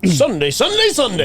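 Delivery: a hint of event-ad hype; hype announcer noise. Co-host cuts in on talk about Sunday, mock announcer hype. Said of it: "you make that noise".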